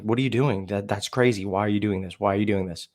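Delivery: usual speaking voice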